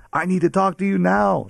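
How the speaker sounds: high, loud insistent demand; upset demand. Retold or imitated. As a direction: mimic the upset girlfriend insisting. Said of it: "She was upset".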